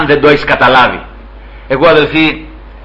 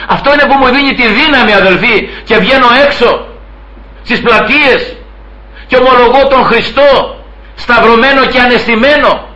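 rising fervent preaching; 6 semitones higher than usual. Acted. Preacher builds to public confession.

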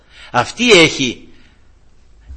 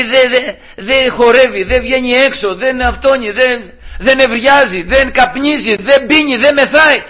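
high, loud wailed list of grievances; exasperated complaint; 12 semitones higher, 7 dB louder, slower than usual. Retold or imitated. Wife accuses husband before the judge, exasperated complaint. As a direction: mimic a wife piling up her complaints.

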